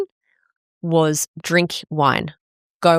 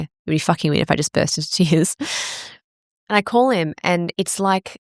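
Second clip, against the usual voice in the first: ordinary talk, nothing marked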